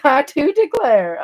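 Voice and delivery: put-on high, loud, slow Southern belle accent through a laugh. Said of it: "I just turned into a Southern belle".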